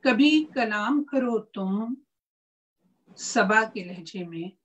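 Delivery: slow, measured verse recital; ghazal recitation. Acted. Poet reciting to a mushaira audience, measured recitation. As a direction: recite the couplet slowly, with pauses between phrases.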